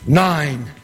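low emphatic repeat. Acted. Storyteller letting the winning count sink in, low and emphatic. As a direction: land it low and firm.

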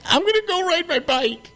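put-on high, whining little boy; mock a spoiled boy begging his mom; high childish whine, mocking; whiny kid voice. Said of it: "He's just a little bitch boy".

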